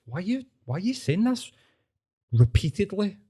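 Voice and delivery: retold "why are you even saying this", voice climbing high; puzzled questioning. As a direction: ask it in mock puzzlement, pitch lifted. Acts out questioning her repeated claim.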